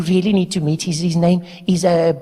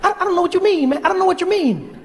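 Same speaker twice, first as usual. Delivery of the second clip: high, fast, breathless fanboy gush; squeaky rushed superfan rambling at his hero; young Obama voice; 13 semitones higher, faster than usual. Acted. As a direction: pitch way up and rattle it off like an overexcited kid. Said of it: "You are speaking so fast".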